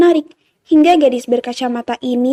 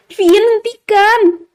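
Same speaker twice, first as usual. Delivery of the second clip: high, drawn-out cry to stop; pleading, high-pitched call; desperate plea; 8 semitones higher, much slower than usual. A narrator's reading. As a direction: beg him, stretching each word. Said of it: "mohon Nara".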